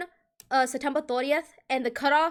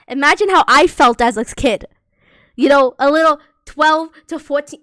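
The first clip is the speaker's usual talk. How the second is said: much louder, exasperated outburst; exasperated exclaim; 13 dB louder than usual. Real, not acted. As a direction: throw it out loud, imagine the confusion. Exclaims at confusion.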